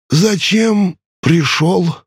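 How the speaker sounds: put-on high, slow, boastful old ferryman; a proud immortal savoring every word; ancient ferryman voice. Charon asks curtly.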